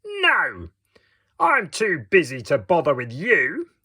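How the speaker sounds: high, loud brush-off from a busy gingerbread man; rude gingerbread man. Acted. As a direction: brush him off impatiently. Gingerbread man refuses rudely.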